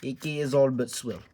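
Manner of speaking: put-on a loose, amateur Russian accent. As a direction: try out a rough Russian accent. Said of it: "I'm gonna try to do a Russian accent".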